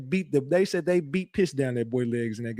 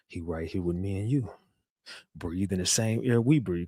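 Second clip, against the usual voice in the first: much lower and softer, quiet menace; imitating Govan low; 7 semitones lower, 7 dB quieter than usual. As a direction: mimic his flat, low hint of a threat.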